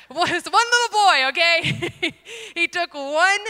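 loud, high, laughing through the words; flustered laughter. Real, not acted. Speaker recovering from an awkward slip before the audience, embarrassed laughing. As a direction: crack up while trying to go on.

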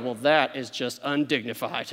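low, soft, stuffy disapproval; mock a snooty onlooker; prim critic. Imagined Super Bowl viewer sniffing at the players, low and stuffy. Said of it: "nobody goes".

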